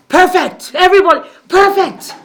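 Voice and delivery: high, loud gushing; exuberant praise. Shown as a demonstration. Speaker praising each person in the group, exuberant. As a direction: shout out the compliment with delight. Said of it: "we don't speak like this".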